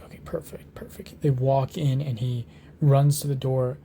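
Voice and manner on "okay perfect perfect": soft whispered perfect; whispered relief. Acted. Harry watching the troll enter the bathroom, whispered relief. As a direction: whisper it to yourself, hiding.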